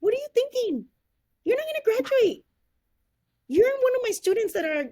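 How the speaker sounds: high, loud scornful counselor; mocking counselor voice. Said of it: "she said".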